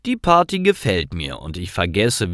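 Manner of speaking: ordinary talk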